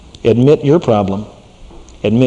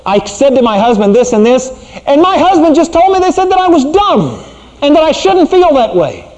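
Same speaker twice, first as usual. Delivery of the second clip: high mock whine of a wife; a wife's high, mocking complaint; mimicking wife; 12 semitones higher, 4 dB louder than usual. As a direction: mimic the upset wife, pitched up. Wife quoting husband, mocking.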